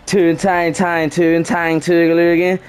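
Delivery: exaggerated Baltimore accent in a chanting run; Baltimorean accent. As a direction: roll out mock Baltimore slang, rising at the end. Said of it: "I turned Baltimorean".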